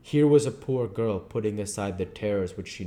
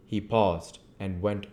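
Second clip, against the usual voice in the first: plain reading voice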